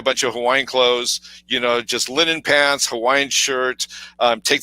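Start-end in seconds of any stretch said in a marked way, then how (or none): none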